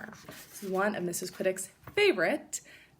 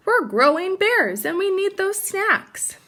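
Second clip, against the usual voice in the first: higher whiny cub protest; 11 semitones higher, 9 dB louder than usual. Acted. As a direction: plead like kids losing their snacks. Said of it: "they protested".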